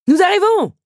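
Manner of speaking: high, loud reply from afar; calling back. Acted. Henri calling back to the countess, high and loud. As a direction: shout the answer across. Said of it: "assura Henri".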